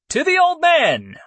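high, loud cheer; cheering toast. A narrator's reading. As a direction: raise the glass and cheer.

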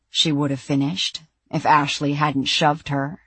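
plain reading voice

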